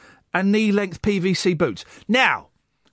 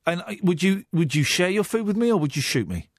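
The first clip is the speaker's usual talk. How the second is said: ordinary delivery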